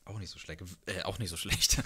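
ordinary delivery

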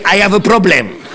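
mock Shakespeare with a Lebanese accent; English words with a heavy Lebanese lilt; playful Lebanese-accented English. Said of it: "il parlait l'anglais avec cet accent".

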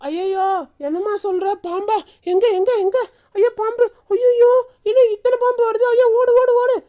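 high, frantic exclamations; alarmed panic. Acted. Grandmother sees many snakes and urges running, panicked. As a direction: cry out as danger closes in.

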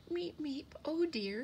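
high, fretful sparrow voice; anxious high chirps; worried chirping. A narrator's reading. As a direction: fret like a little bird, pitched high. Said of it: "he chirped".

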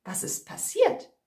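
soft, whispery puzzled question; wondering hunter. A narrator's reading. Hunter outside hears the scream, mildly puzzled. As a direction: muse half to himself, puzzled. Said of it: "Er wunderte sich".

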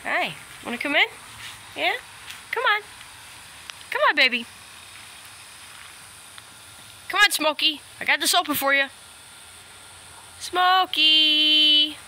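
high coaxing call to a pet; pet talk. Real, not acted. Calling dog in to pen.

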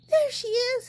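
high, eager cry; excited baby bird. A narrator's reading. Baby bird spots the boat, high and eager. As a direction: spot her and call out. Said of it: "said the little baby bird".

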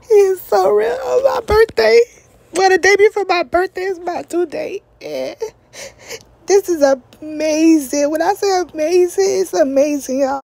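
crying through the words, pitch cracking up; emotional crying. Real, not acted. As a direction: voice breaking high into real tears. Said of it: "I'm so emotional".

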